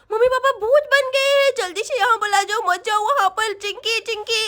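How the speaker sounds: put-on lisping little girl urgently warning; worried little Sonam. Girl warns friend away from ghost parents, worried child voice.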